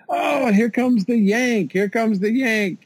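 high, slow, drawn-out call of a race announcer. Retold or imitated. Announcer hails the Yank crossing the line, a high, slow call. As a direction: announce the Yank's arrival over the crowd. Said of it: "I hear the announcer going".

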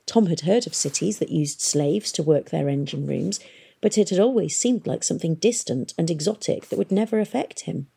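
plain reading voice

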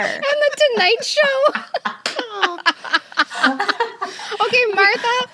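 laughing through the words; cracking up while talking; giggling words, high and bright. Real, not acted. Laughing hard at the guest.

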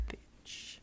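breathy whispered insult. Pretend. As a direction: whisper it under your breath. Whispered insult after threat.

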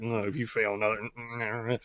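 low, soft mumbled mimicry of a crewmate; mock them with a quiet, low grumble; mocking impression. Pilot mimicking Domino's warning, mocking impression. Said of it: "that's my impression of you right now".

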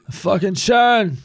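slow, high, loud mock holler; befuddled rock-star bellow. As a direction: drag out a cursing yell for the wife.

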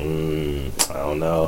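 soft, unsure mumble; timid reluctant murmur; hesitant mumble. Retold or imitated. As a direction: back away from it quietly. Hesitant about holding baby.

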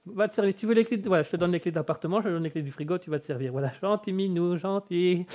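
hushed, nervous placating; mock-fearful appeasing. Owner offering the cat his keys, hushed appeasing. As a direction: soothe a dangerous cat under your voice. Amadoue le chat menaçant.

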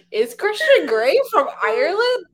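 high, loud incredulous question. Retold or imitated. Viewer questioning Jamie Dornan's accent in Fifty Shades, incredulous.

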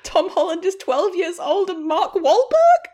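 high, laughing disbelief; incredulous outrage. Real, not acted. Griping to a friend about casting, in laughing disbelief. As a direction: sound baffled and outraged through a laugh.

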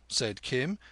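ordinary delivery

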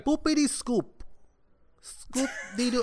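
high goofy singsong; silly singsong. Pretend. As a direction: sing it out like a cartoon. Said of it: "where can the good people find you".

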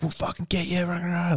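high, hushed imitation of gang aggression; mock threats. Crackheads ganging up on old guy, muted mock menace. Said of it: "they start ganging up on him".

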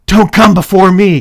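loud, high commanding order; demanding. Acted. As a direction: bark it as an order. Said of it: "Caitlin demanded".

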